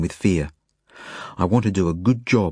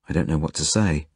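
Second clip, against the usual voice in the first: usual speaking voice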